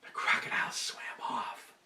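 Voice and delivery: soft hushed storyteller; whispered narration. A narrator's reading. Storyteller hushing the ending, whispered. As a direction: let it fade out in a whisper.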